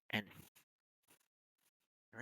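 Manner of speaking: "f" as a soft hissing f; f sound. Shown as a demonstration. Teacher contrasts F with P. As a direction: let air through the lips for "f". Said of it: "difference between the P and F".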